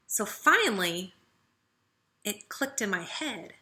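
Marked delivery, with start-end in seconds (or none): none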